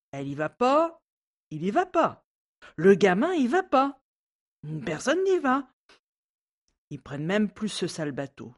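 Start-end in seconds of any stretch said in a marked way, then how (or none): 0.1-5.7 rising higher and louder each line; building exasperation. Acted. Maid listing who avoids the lake, climbing exasperation. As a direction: pile it on, climbing.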